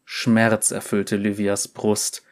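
ordinary delivery, nothing marked

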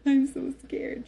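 high, loud scared outburst; retold fear. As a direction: re-enact a frightened cry to the midwife. Retells her fear during labour.

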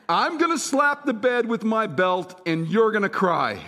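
insistent dad driving home "you're gonna cry"; emphatic repeat. Retold or imitated. Dad repeats plan to confused boy.

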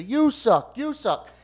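high, loud mock yelling back and forth; retold yelling. Two rival fans trading insults, high loud mock yelling. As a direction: trade the insult like two hecklers. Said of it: "they just start yelling that".

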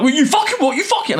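loud, very high outraged outburst; imitated antagonised driver. Storyteller playing the driver he just antagonised, angry outburst. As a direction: explode as the provoked motorist. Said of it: "antagonised him".

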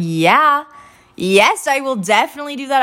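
re-enacted keen, bright-eyed yes; eager agreement. Student accepting her internship supervisor's request, eagerly.

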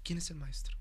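near-whispered pointed question; hushed admonishment. Retold or imitated. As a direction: lean in and ask it almost in a whisper. Brother's quiet admonishment, retold.

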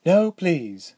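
a touch of impatience; impatient dismissal. A narrator's reading. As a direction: brush off the formality. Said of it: "waving the request away impatiently".

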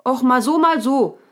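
high, loud character voice; hard-of-hearing raccoon. A narrator's reading. Raccoon who didn't catch the answer asks, high and loud. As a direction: call out the question in a high pitch.

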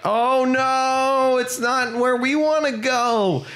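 high, whiny mock dismay; mock dismayed orcs. Orcs finding the portal goes elsewhere, high mock dismay. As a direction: squeak it like disappointed orcs. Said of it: "are like".

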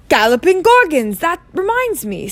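high, loud burst of remembering; sudden exclamation. A narrator's reading. Hagrid remembering the owl, exclaiming suddenly. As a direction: exclaim as it hits you.

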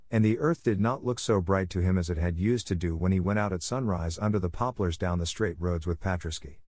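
ordinary delivery

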